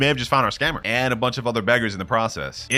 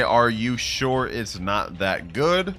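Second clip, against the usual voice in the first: very slow, even dictation; typing aloud; much slower than usual. Acted. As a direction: stretch the question out word by word. Dictates his question while typing.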